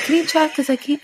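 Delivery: put-on high, helpless kid voice; pleading kid. Mom imitating her little son, high and helpless. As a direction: plead like a small child.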